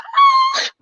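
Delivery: very high, sudden mock scream; a short shrieked "ah" of dismay; mock anguished scream. Reacting to Vader's turn.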